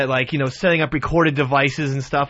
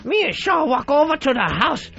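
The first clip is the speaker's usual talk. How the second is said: high, far-off voice doing Yoko's broken English; put-on [name] accent, pitched way up; Yoko impression; 7 semitones higher than usual. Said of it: "that impression".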